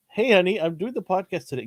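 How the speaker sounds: light, casual announcement to wife; lightly voiced sheepish husband; breezy husband.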